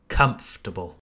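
"Comfortable" as low, falling KUMF-tə-bəl; repeat-along model. Shown as a demonstration. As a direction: model "Comfortable" in three syllables. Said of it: "Let me hear you say it".

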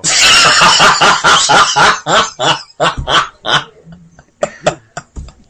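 long, loud belly laugh; laughing fit. Real, not acted. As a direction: crack up loudly and keep going. Laughing at own movie story.

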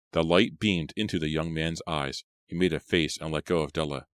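plain reading voice

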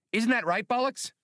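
squeaky little droid teasing; high droid voice. Acted. Blue Max droid continues speaking.

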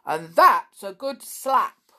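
loud blunt villager; gruff villager. A narrator's reading. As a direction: punch out the slap. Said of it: "said Mister Gomp".

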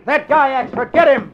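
high, loud call to a partner; shouting after him. Acted. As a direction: yell across the hall to grab him. Reporter shouts as the crook runs.

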